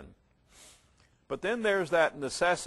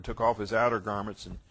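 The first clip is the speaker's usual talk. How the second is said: ordinary talk, nothing marked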